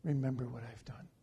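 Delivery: whisper-close plea to God; king begging the Lord softly, quiet plea; quiet prayer. Acted. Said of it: "prayed to the Lord, saying".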